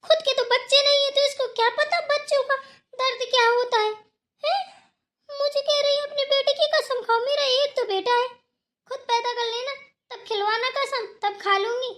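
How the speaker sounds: high-pitched, indignant tirade; outraged protest. Acted. Refusing to swear on her only son, outraged protest.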